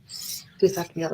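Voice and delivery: ordinary talk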